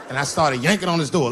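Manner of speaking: ordinary delivery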